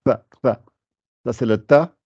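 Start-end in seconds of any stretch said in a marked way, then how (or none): 0.0-0.6 "tha" with the tongue between the teeth. Shown as a demonstration. Teacher shows students where the tongue goes, clear demo. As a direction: stick the tongue out a little for "tha". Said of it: "faut sortir un peu la langue".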